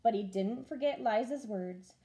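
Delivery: ordinary delivery, nothing marked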